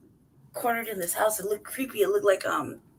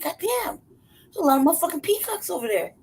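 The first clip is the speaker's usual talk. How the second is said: amazed; 9 semitones higher than usual. Retold or imitated. Amazed at the peacocks.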